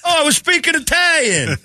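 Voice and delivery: high mock Italian producer; hammed-up Italian accent protest; fake Italian accent.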